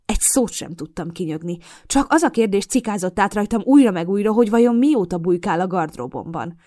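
usual speaking voice

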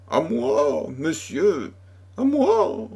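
high-pitched plea rising to a shriller cry; crying for help. A narrator's reading. Girl calls the chevalier to her rescue, crying out. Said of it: "cria la voix de Loïse".

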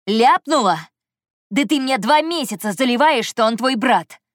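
indignant, loud and high; angry outburst. A narrator's reading. Anton confronts heroine over the lie, angry outburst. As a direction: rage at being lied to. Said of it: "взревел он".

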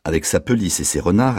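usual speaking voice

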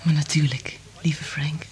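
soft whisper, fading away; tender whisper. Acted. Liz grants Frank his wish in a tender whisper. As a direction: breathe it to him gently.

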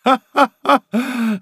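high, slow, spelt-out ha-ha-ha; staged laugh. A narrator's reading. Malicorne laughs at the gossip, staged laugh. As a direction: laugh it out high and deliberately, syllable by syllable. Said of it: "засмеялся Маликорн".

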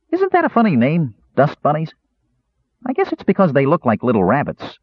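high curious kender voice; chirpy high youngster. A narrator's reading.

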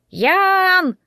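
high, loud drawn-out squawked call; squawking parrot cry; parrot screech. A narrator's reading. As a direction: shriek the name like a parrot at full throat. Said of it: "во всю птичью глотку крикнул попугай".

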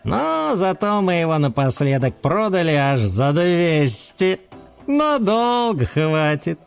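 blissful. Acted. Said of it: "блаженно откликнулся Мэтт".

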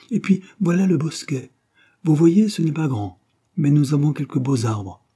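a hint of an eager Hélène; lightly voiced young hostess. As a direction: point out the grounds in a lifted voice. Voices Hélène showing the grounds.

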